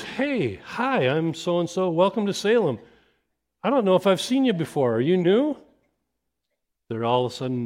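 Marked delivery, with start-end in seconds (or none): 0.0-5.6 bright, high, eager welcome; cheerful greeter. Retold or imitated. Greeter welcoming a new family at the door, high and bright. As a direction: greet them like a long-lost friend. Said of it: "A greeter meets them and goes".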